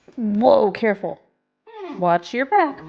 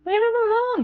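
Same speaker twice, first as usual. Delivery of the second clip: high pet scolding; 12 semitones higher, faster than usual. Real, not acted. Scolding dog gently.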